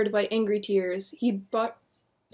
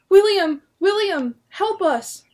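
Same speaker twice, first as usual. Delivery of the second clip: high, loud desperate cries; crying for help; 10 semitones higher, 8 dB louder, slower than usual. Acted. Wife calling her husband for help, desperate cry. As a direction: scream for your husband. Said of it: "Martha cried".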